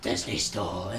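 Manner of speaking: mock zombified moan trailing into a drone; zombie voice. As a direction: groan it out like the walking dead. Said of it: "kind of like The Walking Dead".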